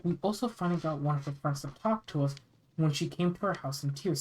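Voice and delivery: usual speaking voice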